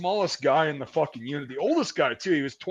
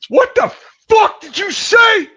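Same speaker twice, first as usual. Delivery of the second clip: high, loud outraged shout; imitated furious challenge; angry confrontation; 11 dB louder than usual. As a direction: yell it like starting a fight. Retold fight breaking out nearby.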